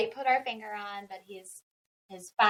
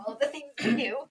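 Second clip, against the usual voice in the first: laughing resignation; 2 semitones higher, faster than usual. Real, not acted. Laughing over parental sacrifices.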